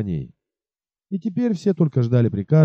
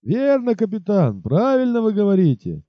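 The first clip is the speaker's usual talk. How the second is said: high, eager chorus of soldiers; rowdy soldiers agreeing at once; 7 semitones higher than usual. Acted. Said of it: "хором подхватили солдаты". (хором, как солдаты)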